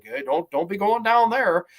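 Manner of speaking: lightly put-on Irish accent; a touch of Irish brogue on the warning. Grave digger warns the couple off, light Irish accent. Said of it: "He has this Irish accent in it".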